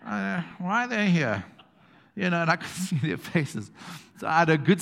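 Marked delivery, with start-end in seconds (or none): 0.1-1.4 higher-pitched baffled muttering, imitated; mock disbelief. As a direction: mimic onlookers taken aback by unwelcome guests. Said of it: "the look on their faces".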